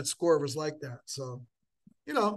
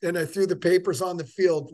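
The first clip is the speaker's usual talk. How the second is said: usual speaking voice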